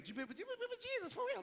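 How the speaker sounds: high, fast prying question; a nosy voice, high and quick; pressing question. Acted. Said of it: "they say".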